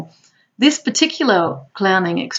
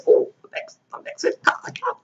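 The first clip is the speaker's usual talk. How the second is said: strained, breathy mock groaning; hammed-up pained whine; mock back pain; 9 semitones higher than usual. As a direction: ham up a clown with a sore back. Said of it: "my back goes".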